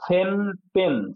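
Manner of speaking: সেন্টেন্স slowly split as সেন, টেন্স; syllable by syllable. Shown as a demonstration. Teacher demonstrating syllable count to pupils, সেন্টেন্স split slowly in two. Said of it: "এই যে উচ্চারণ করছি আমি".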